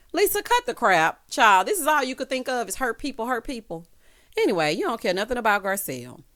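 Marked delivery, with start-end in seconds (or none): 0.1-1.7 loud sassy telling-off; exasperated callout. Real, not acted. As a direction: snap at her to stop. Telling off Lisa.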